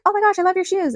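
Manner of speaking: high, loud excited squeal of admiration; gushing compliment, imitated. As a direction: gush like a delighted passerby. Quoting passerby's gushing compliment.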